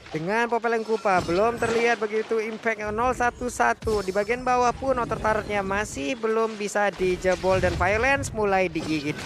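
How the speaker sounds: usual speaking voice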